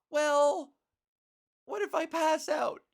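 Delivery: high, loud playful voice with a laugh; high feminized voice. Real, not acted. Joking about passing out while training, in a high loud voice. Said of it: "modulating my voice".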